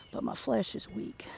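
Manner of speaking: fading to a whisper; weary whisper. Real, not acted. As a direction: sigh it out, worn out. Tired joke while working.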